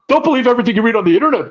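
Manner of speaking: high, rapid mocking whine; whiny imitation. Wrestler scolding fans about internet rumors, imitated as whiny. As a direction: mimic his complaint in a fast, high whine. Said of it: "he got whiny".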